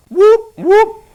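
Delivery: high loud siren whoop; police siren imitation. Shown as a demonstration. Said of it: "That's the sound of the police".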